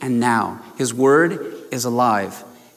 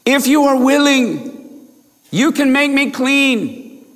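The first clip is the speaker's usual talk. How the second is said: high, slow pleading voice as the leper; imploring leper; 11 semitones higher, slower than usual. A narrator's reading. Leper kneeling before Jesus, pleading high and slow. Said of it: "imploring him".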